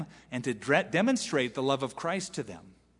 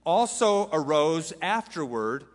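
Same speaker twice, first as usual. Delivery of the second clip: slow, raised preaching read; dramatic scripture reading; 4 semitones higher, 4 dB louder, slower than usual. Acted. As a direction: proclaim the verse slowly and loudly. Preacher reads scripture with force.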